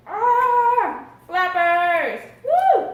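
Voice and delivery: high, loud squeal of delight; excited shout. Real, not acted. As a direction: cheer it out loud. Excited about chicken wing.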